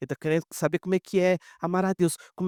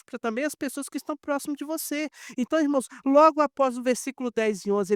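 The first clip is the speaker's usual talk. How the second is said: raised, fervent preacher voice; preacher pressing the church to be an example, fervent and raised; preaching; 7 semitones higher than usual. Acted. Impassioned sermon climax.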